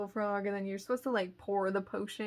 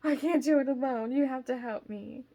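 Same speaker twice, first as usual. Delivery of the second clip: raised, louder frightened pleading; mock panicked plea; 4 semitones higher, 6 dB louder than usual. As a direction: beg for backup, playing up the fright. Acted plea to husband when scared.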